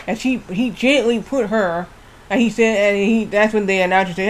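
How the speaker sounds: usual speaking voice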